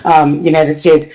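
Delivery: ordinary delivery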